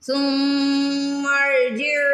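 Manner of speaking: ثم with the م held long through the nose; nasal ghunna demo. Shown as a demonstration. Teacher showing students the ghunna on ثم, slow chant. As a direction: hum the م of ثم nasally before ارجع, very slow. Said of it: "یہاں پہ غنہ کریں گے، ناک میں آواز لے کے جائیں گے".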